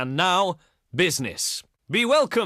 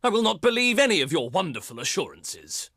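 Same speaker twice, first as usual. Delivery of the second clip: normal talking voice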